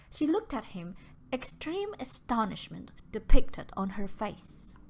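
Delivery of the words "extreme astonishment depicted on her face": plain reading voice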